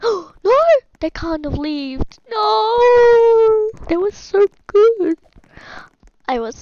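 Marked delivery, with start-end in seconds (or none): 0.0-0.6 high, loud "no" after a gasp; gasp, then cry out; gasping dismay. Real, not acted. Player losing the Freddy army, gasping cry. 2.3-5.2 high, loud drawn-out lament; dramatic wailing. Real, not acted. Player mourning lost army, loud wail. Dismayed at losing game characters.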